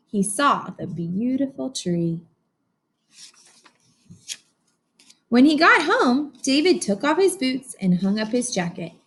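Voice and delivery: lively read-aloud storytelling; story narration. A narrator's reading. Teacher reading to her class, animated storybook narration. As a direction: read it like a picture book to a class.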